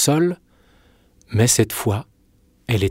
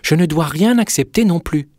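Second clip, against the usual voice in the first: put-on higher, girlish storybook voice; raised-pitch innocent girl; 6 semitones higher, 3 dB louder than usual. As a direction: refuse as an obedient little princess.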